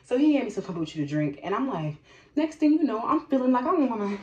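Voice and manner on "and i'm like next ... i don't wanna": usual speaking voice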